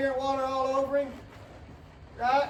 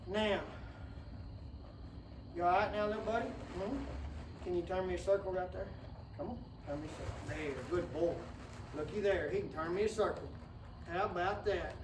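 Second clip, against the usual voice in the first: coaxing the colt; 7 semitones lower, 9 dB quieter than usual. Real, not acted. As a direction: coax the colt through a circle, quietly.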